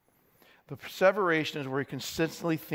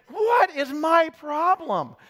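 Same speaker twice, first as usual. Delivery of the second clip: higher, slow, exasperated at himself; bewildered; 9 semitones higher, 3 dB louder, slower than usual. Retold or imitated. Preacher acts out baffling at his own mistake, higher, slow. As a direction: stretch it out in baffled disbelief. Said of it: "So there's this bewilderment".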